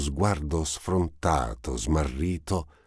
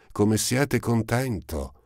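high and slow, a hidden bitterness; ominous undertone; 8 semitones higher, slower than usual. A narrator's reading. Eponine remarks on Marius's joy, slow and uneasy. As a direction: let a dark note sit under the words. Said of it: "con un tono che avrebbe impensierito".

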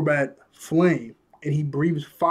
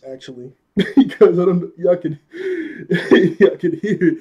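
real giggles breaking up the words, louder; laughing; 3 semitones higher, 6 dB louder than usual. Real, not acted. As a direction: try to talk through a laughing fit. Breaks up laughing at own speech.

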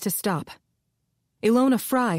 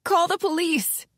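higher, urgent frightened plea; pleading; 11 semitones higher than usual. A narrator's reading. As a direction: beg them to call for help. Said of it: "Ilona pleaded".

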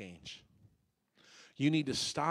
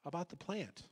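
ordinary delivery

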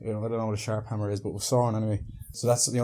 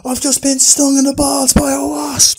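frantic high scream, very loud; 14 dB louder than usual. Retold or imitated. Stung man explaining himself to the girl, screaming. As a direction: shriek it red in the face. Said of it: "screaming at her".

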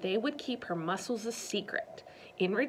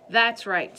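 through gritted teeth; 10 dB louder than usual. Acted. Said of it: "through gritted teeth".

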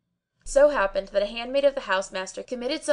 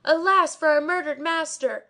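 high, loud lament in a changed voice; mad shouting; 8 semitones higher, 3 dB louder than usual. A narrator's reading. Barber shouting wildly before the crowd. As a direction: wail like a madman. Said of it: "shouting like a madman".

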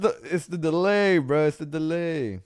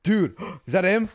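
usual speaking voice

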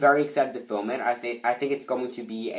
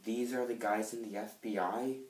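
soft, let-down question; sad; 9 dB quieter than usual. Acted. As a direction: ask it quietly, sadly disappointed. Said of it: "that sounded so sad".

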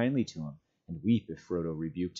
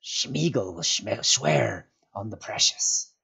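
slow creature oath; slower than usual. A narrator's reading. Gollum offers oath.

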